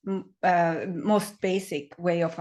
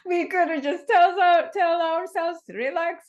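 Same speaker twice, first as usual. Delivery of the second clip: high-pitched, laughing through the words; 10 semitones higher, 5 dB louder than usual. Real, not acted. Coach joking that guidance would be needless, laughing. Joking about simply telling oneself to relax.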